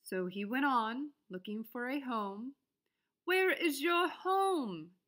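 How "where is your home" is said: high, slow called-out question; bunny calling. A narrator's reading. Bunny calling to the frog, high and slow. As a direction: call out across the pond. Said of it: "he asked the frog".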